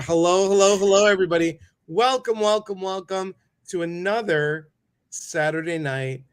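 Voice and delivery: high, loud showman greeting; excited host welcome. Acted. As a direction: throw open the show like a game host. Host greeting the live audience.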